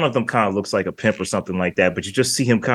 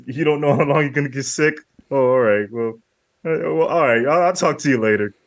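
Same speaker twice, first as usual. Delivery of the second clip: a lightly voiced, higher, fumbling [name]; awkward phone brush-off; 4 semitones higher than usual. [name] giving up on the call, awkward and higher. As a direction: stammer through it like a rejected caller.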